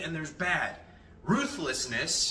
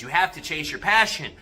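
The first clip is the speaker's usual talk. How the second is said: ordinary talk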